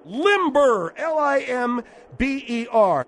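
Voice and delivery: limber, then each letter spelt slowly and loudly; spelled out. Shown as a demonstration. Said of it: "I said limber".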